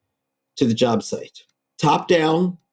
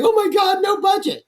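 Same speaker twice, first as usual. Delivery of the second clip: high excited inner shout. Retold or imitated. Shouting to himself at the budget news. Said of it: "inside my heart is going".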